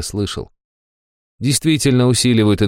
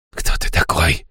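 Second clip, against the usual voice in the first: hoarse defeated whisper. A narrator's reading. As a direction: whisper it, beaten. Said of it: "прошептал он".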